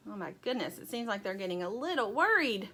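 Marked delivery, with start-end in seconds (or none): none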